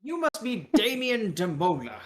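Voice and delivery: hearty British caricature greeting; British accent. Acted. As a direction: draw out the name in a British accent. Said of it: "with a British accent".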